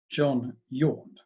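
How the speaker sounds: usual speaking voice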